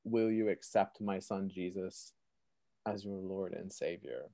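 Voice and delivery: soft, unhurried, solemn invitation; quiet voice of God. Retold or imitated. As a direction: quote God gently, well under normal volume. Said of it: "I hear this voice say".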